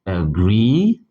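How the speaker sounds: agree, slow with the weight on -gree; stress on the second syllable. Shown as a demonstration. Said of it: "such as agree".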